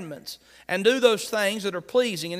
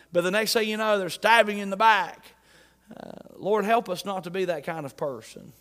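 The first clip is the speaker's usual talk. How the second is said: ordinary talk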